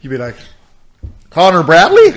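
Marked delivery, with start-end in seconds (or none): 1.3-2.1 loud, high shouted name; mock call-out. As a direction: belt the name out in jest. Imitates calling the name out in custody.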